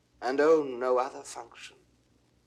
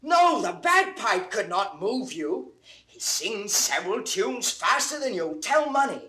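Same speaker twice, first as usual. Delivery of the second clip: higher, louder eager calls that build into a gushing report; excited calling; 7 semitones higher, 5 dB louder than usual. Acted. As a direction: burst in breathless with news for the master. Servant bursts in calling master.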